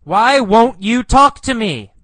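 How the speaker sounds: high, loud shout after her; yelled plea. Acted. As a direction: yell it at someone ignoring you. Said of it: "He yells".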